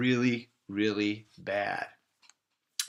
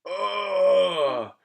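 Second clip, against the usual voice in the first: high groan; 8 semitones higher, 4 dB louder than usual. Acted. Stranded on Mars.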